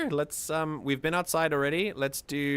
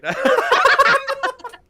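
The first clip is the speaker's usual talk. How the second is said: high, loud burst of laughter; real high-pitched laughing fit; 9 dB louder than usual. Real, not acted. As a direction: crack up helplessly. Cracks up at own name-calling.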